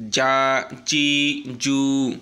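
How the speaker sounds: "জা, জি, জু" loud, each vowel held one beat; one-beat stretch. Shown as a demonstration. Teacher reading together before learners repeat, lengthened "জা, জি, জু". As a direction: chant "জা, জি, জু" with long even vowels. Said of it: "এক টান হবে শুধু".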